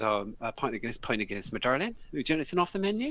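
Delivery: Lincolnshire barmaid. Retold or imitated. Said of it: "And I said".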